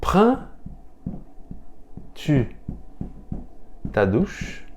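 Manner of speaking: "Prends-tu... ta douche?" with a long pause; word by word. Shown as a demonstration. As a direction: lift "Prends" high, then space out the rest. Teacher models inverted question slowly.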